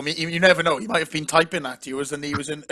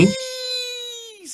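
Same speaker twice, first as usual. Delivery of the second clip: very high, drawn-out mock cheer; comic falsetto cry; silly squeal. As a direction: squeal it and stretch the ending. Silly squealed team nickname.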